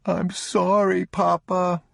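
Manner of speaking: put-on a child pleading through tears; sobbing boy. A narrator's reading. Boy apologizing to his father again, near tears. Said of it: "He was beginning to sob".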